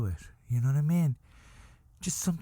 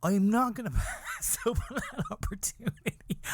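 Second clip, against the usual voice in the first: laughing through the words, higher; can't keep the laugh down; giggling confession; 8 semitones higher than usual. Real, not acted. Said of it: "I'm sorry to be laughing".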